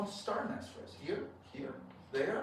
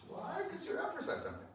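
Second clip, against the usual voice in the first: ordinary talk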